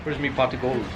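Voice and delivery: lightly voiced Irish leprechaun; playful Irish brogue, pot of gold. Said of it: "Lucky Charms".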